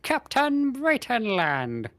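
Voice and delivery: mock British herald, high and very slow; badly faked British accent, drawn-out high call; attempted British accent. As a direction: stretch it grandly in hammy British. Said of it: "That was not a good".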